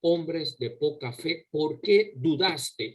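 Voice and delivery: very slow, stressing "Hombres" and "fe"; emphatic rebuke. Acted. Preacher voicing Christ's rebuke to the disciples, very slow. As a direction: drag out each word as a solemn reproach. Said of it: "donde Cristo enfatiza".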